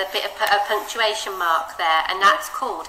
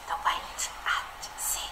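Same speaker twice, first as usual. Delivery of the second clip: soft, slow whisper; 11 dB quieter, much slower than usual. Shown as a demonstration. Teacher showing the pause words, whispered. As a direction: breathe it like a secret. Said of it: "don't forget".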